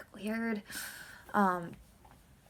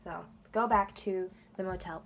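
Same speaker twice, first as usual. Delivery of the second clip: ordinary talk, nothing marked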